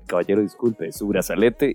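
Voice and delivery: security guard. Retold or imitated. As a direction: play the polite guard, low and soft. Imita al guardia de seguridad. (guardia de seguridad imitado, grave y bajito)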